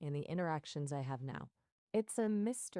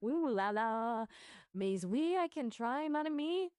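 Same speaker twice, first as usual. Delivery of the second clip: French accent; 7 semitones higher than usual. Shown as a demonstration. AI assistant obliging a request for an accent, French accent. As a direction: put on a stretched-out French accent. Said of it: "Can you talk in a French accent".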